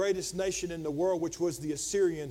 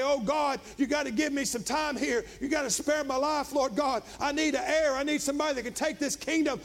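higher-pitched pleading, building to a shout; acted desperate plea; 7 semitones higher than usual. Preacher voices dying Hezekiah begging God, acted desperate plea. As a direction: beg for life in a preacher's retelling. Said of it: "begins to weep and cry".

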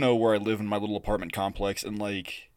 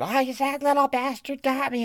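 put-on high, shrieking old woman complaining; high old lady wail; 4 dB louder than usual. Soaked old neighbor wailing at the kid. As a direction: squeal like an angry old lady. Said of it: "And she's, like".

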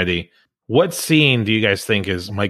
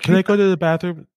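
put-on higher, silly man-child voice; childish Ruprecht; 9 semitones higher than usual. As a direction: ask it like a goofy overgrown kid.

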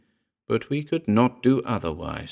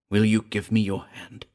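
plain reading voice

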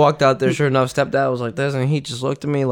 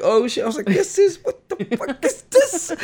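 shocked squeal. Retold or imitated.

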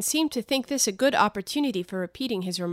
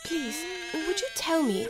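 timid; 7 semitones higher, 5 dB quieter than usual. Acted. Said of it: "said Alice a little timidly".

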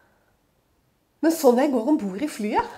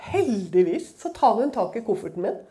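ordinary talk

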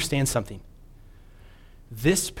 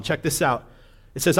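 usual speaking voice

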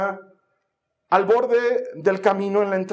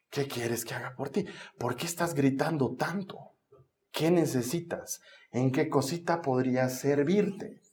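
low, soft, mock-helpful questioning; mildly sarcastic; 5 semitones lower, 7 dB quieter than usual. Jesus imagined asking the blind man what he needs, mildly sarcastic. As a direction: ask softly with a touch of irony. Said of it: "Oye, no seas sarcástico".

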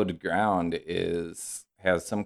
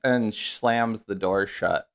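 ordinary talk

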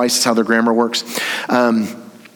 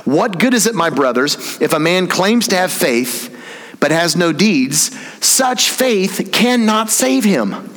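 emphatic, higher-pitched reading, slowing to a forceful verdict; aggressive reading; 5 semitones higher, slower than usual. Shown as a demonstration. Preacher rereading the verse as it should read, aggressively emphatic. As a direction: read it as a hard statement, drawn out at the end. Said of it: "that's, that's really aggressive".

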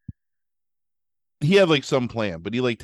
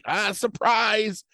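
creepy surprise; 11 semitones higher, much slower than usual. Retold or imitated. Killer jumps out of dumpster.

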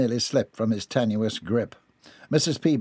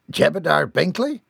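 "Jebediah Binkley" higher, rising into a question; incredulous echo; 5 semitones higher, 4 dB louder than usual. A narrator's reading. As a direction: repeat "Jebediah Binkley" in disbelief, voice going up. Father echoes name in disbelief.

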